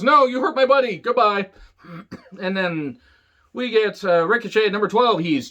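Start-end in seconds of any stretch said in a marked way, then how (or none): 0.0-1.5 mock upset. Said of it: "Big E gets upset at Ali and says".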